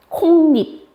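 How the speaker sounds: 콩잎 high, as 콩닙; inserted n pronunciation. Shown as a demonstration. Teacher answering how it sounds, 콩잎 with inserted ㄴ. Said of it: "얘 발음 어떻게 나?".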